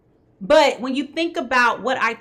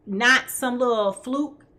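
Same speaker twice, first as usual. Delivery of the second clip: ordinary talk, nothing marked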